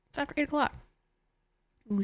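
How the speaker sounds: usual speaking voice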